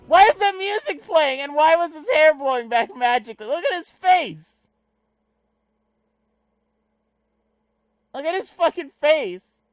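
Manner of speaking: high-pitched, full-volume ranting; incredulous yelling. Real, not acted. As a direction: shriek in disbelief at what you're watching. Yelling in disbelief at movie clip.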